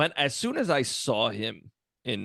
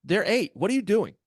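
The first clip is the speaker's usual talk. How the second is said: high and louder, fed up; exasperated scolding; 9 semitones higher, 5 dB louder than usual. Retold or imitated. Teacher scolding middle schoolers for picking on second graders, exasperated. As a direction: scold them hard, disbelieving. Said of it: "I'm like".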